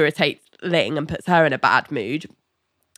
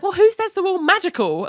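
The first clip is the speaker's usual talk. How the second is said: high mock voice; 12 semitones higher than usual. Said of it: "Melanie's like".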